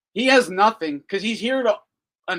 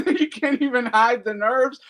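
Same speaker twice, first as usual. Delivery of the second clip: laughing retake, louder and higher; 8 semitones higher, 4 dB louder than usual. Real, not acted. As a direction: crack up while repeating it. Repeats line, laughing and louder.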